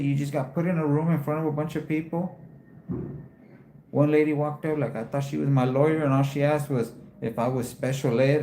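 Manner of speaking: ordinary delivery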